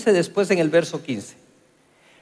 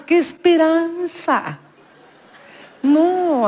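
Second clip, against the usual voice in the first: high, slow, drawn-out ironic exclamation; exaggerated scoff; 10 semitones higher, much slower than usual. Preacher scoffing that angry women will give in, drawn out. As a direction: stretch it out, mocking the idea.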